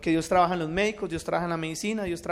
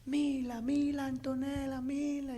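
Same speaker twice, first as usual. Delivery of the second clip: retelling the wife's frantic call, higher but quiet; retold calling out; 7 semitones higher, 6 dB quieter, much slower than usual. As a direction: call the daughter's name in a lightly imitated panic. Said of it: "mi esposa empezó a gritar".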